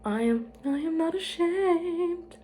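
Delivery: slow, high, put-on voice for "I am not ashamed"; high dramatic denial.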